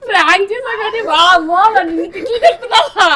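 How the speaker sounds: high and loud through laughter; laughing. Real, not acted. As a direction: burst out laughing as the prank is revealed. Prank revealed, laughing. (నవ్వుతూ గట్టిగా)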